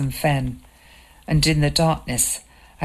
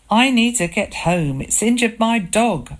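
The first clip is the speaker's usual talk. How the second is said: shrieking; 7 semitones higher than usual. A narrator's reading. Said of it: "I shrieked at him".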